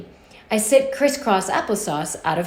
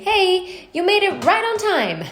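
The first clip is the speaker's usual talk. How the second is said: high, bright little voice of a beeping clock; lightly voiced talking clock; chirpy clock voice; 10 semitones higher than usual. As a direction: chirp it like a cheerful alarm clock. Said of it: "as if it was saying".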